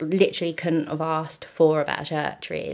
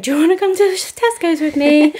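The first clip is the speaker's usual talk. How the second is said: high, loud wheedling plea; hammed-up pleading little princess voice; needy princess; 11 semitones higher, 9 dB louder than usual. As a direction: beg dad sweetly like a spoiled princess. Quoting her needy past self.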